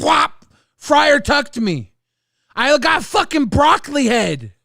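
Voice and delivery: loud, high indignant rant; complaining loudly about a bad haircut; outraged complaint. Real, not acted. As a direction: loudly bemoan the ruined hair.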